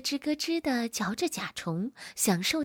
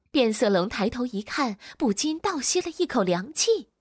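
ordinary delivery

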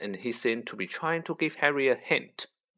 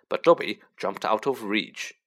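ordinary delivery

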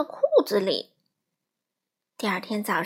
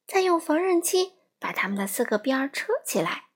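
ordinary delivery

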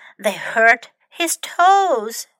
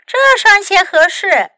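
bright and lifted, clearly pleased; happily; 7 semitones higher, 7 dB louder than usual. A narrator's reading. 朱乐终于穿上合脚的鞋，高兴地说. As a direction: say it like the shoes finally fit. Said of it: "朱乐高兴地说".

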